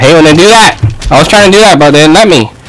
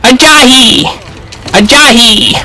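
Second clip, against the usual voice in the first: battle cry; 10 semitones higher than usual. Real, not acted. Gamer crying out mid-fight, high battle cry. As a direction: cry out high, without more volume.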